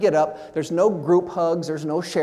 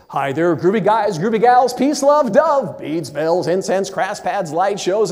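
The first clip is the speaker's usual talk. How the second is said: groovy hippie radio DJ patter; disc jockey routine; 3 semitones higher than usual. Acted. Speaker recites a memorized comedy record for the audience, hammed-up disc jockey. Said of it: "this little disc jockey routine in it".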